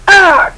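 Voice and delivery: high, loud abrupt yell; cry of pain. Acted. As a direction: yell as a blow lands. Boba cut off by blow.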